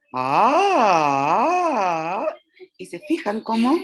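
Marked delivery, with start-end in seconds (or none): 0.1-2.3 ah rising then falling low, wavering. Shown as a demonstration. Teacher to class, demonstrating a low tone. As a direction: swoop down into a low pitch. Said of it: "un tono grave".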